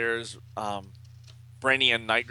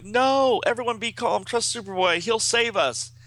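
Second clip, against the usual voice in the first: high, loud rallying hero; raised, emphatic comic-book exclamation; Ultra Boy; 12 semitones higher, 5 dB louder than usual. A narrator's reading. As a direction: urge the team on urgently. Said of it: "And Ultra Boy's like".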